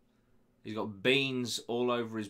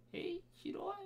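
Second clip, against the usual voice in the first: high, playful mock Australian accent; 13 dB quieter than usual. Host asking whether to carry on, in Australian accent. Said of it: "should I finish this in an Australian accent".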